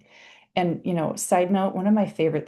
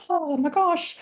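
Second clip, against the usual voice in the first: mock excitement; 12 semitones higher than usual. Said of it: "hubbub about polyvagal theory".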